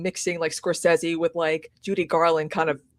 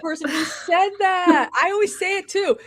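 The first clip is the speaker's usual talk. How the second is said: real exclamation; 8 semitones higher, 5 dB louder than usual. Real, not acted. Guest told she has no accent, exclaiming. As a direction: burst out high at hearing it yet once more.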